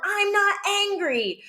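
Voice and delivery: high, stretched-out indignant yell; mock child's scream. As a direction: imitate a kid hollering a denial. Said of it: "if they scream at me and say".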